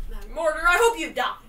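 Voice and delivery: loud, high playful threat; hammed-up grudge; mock hateful curse. Real, not acted. Cursing the Pokemon.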